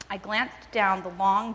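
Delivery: plain reading voice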